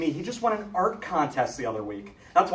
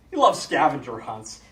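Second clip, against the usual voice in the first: normal talking voice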